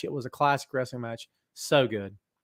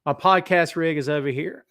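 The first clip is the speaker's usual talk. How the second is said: usual speaking voice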